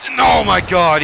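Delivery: loud, high shout of amazement; announcer exploding at the big finish; excited call. Acted. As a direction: erupt as the finisher lands. Finisher hits during commentary.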